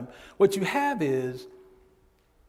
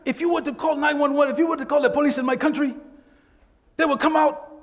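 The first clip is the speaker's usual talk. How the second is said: higher, louder, fast and laughing; quoting a client's story; 10 semitones higher, 5 dB louder, faster than usual. Retold or imitated. Instructor quoting a client's story, fast and laughing at the end. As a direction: retell the client's punchline with a laugh. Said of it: "he said, you know".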